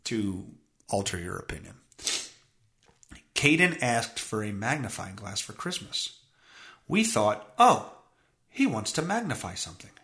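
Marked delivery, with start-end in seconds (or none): 3.3-9.9 low, level, uninflected reading; no inflection. Shown as a demonstration. Host reads the parents' quote to listeners with no inflection. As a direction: read it out deadpan, giving no opinion. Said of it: "no inflection on my voice".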